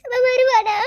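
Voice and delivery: weeping bride cries out for her husband; loud crying. Acted. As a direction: break down fully on வருவானா. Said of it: "அழாத சஞ்சு".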